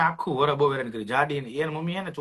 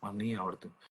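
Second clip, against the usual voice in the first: softer, coaxing plea in a low voice; sweet pleading; 8 semitones lower, 10 dB quieter than usual. Retold or imitated. Boy coaxing the girl beside him in the exam, softly pleading. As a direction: gently beg her to show the answers, softer. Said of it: "આપણે પરીક્ષામાં તો આપણે એમ જ પ્રેમથી જ કેવું પડે".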